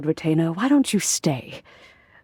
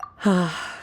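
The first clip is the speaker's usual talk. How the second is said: wistful sigh. Real, not acted. [name] looks very emotional.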